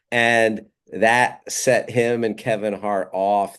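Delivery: normal talking voice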